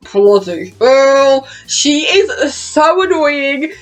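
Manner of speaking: slow, emphatic venting about someone insufferable; exasperated. Real, not acted. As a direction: stretch it out in fed-up annoyance. Ranting about a hated character.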